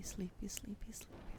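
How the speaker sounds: ordinary delivery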